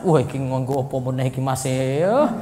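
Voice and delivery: lightly voiced Javanese villager complaining; suspicious villager. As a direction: grumble as a doubting listener. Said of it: "Nanti dituduh macam-macam".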